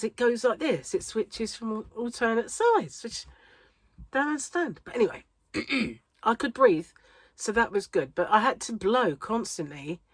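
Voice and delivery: ordinary talk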